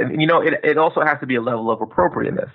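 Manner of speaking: ordinary talk, nothing marked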